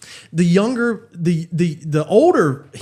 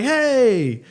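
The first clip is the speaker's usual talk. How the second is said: high hey called down the slope; calling back; 9 semitones higher than usual. Retold or imitated. Friends on the chairlift calling back down, high hey. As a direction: shout a greeting back down.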